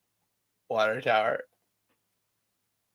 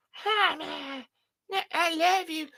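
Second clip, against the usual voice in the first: high, softened cutesy voice; tiny high affectionate squeak; doting on a toy; 4 dB quieter, slower than usual. Pretend. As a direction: coo lovingly at a tiny figure. Talks to toy figure.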